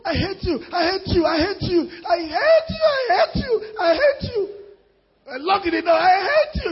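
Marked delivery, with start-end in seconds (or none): 0.1-4.5 high, wailing I hate you, over and over; mock tantrum, Oyibo wife. Preacher acts an Oyibo wife storming into her room, wailing. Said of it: "you know that's Oyibo". 6.0-6.7 high, hammed-up I hate you; mock tantrum wife. Preacher mimics a wife locking the door, wailing. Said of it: "enter her room and bang the door".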